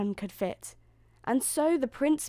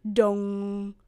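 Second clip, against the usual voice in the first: long, loud monotone dong; clock chime; 5 dB louder than usual. A narrator's reading. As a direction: ring out like a striking clock. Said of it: "said the clock".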